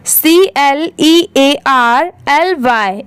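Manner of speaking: normal talking voice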